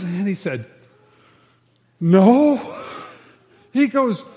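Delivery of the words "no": blunt no. Retold or imitated. Drunk answers after dunking.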